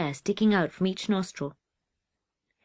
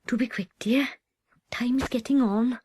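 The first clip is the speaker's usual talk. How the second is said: timid whisper; 4 semitones higher than usual. Acted. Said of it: "Miss Evans whispered timidly".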